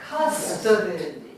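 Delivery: slow CUS-to-dy, stress up front; first-syllable stress. Shown as a demonstration. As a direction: stretch the CUS of custody, let the rest fall. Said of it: "We don't say custody".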